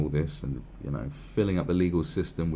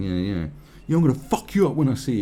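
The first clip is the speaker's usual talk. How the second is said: mock tough guy threat; 6 semitones higher, 6 dB louder than usual. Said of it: "you people talking shit going".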